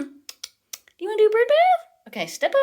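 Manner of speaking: very high pet-talk coaxing. Real, not acted. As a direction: lure the bird with a high sing-song. Coaxing parrot onto hand.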